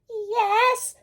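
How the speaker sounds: high squeaky fake voice; wolf faking a small voice, squeaky; squeak. A narrator's reading. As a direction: squeak it to sound innocent. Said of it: "squeaked the wolf".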